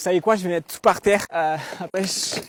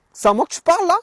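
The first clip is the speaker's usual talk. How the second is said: higher, louder, jeering tough-guy challenge; hammed-up tough guy; 10 semitones higher, 10 dB louder, faster than usual. À un voleur qui lui demande son portefeuille, défi de caïd surjoué. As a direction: puff up and jeer the question like a street tough.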